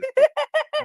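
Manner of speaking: howling laugh; burst of laughter. Real, not acted. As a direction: crack up at the clip. Streamer reacts to a funny clip.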